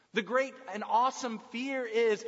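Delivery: ordinary delivery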